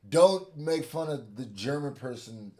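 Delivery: slow, loud mock German accent; a loud German accent, dragged out slowly. Retelling an offended Indian man.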